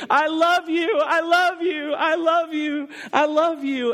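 high, loud, tearful cries; retold weeping cry. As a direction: sob it out like an overwhelmed child.

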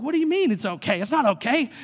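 outraged daddy; indignant dad. Retold or imitated. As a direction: protest it's not okay, loud and high. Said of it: "I was like".

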